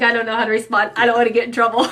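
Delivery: playful put-on reluctance; mock nervous reply. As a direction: tease him with his worried answer, laughing. Mock-voices the husband's nervous answer.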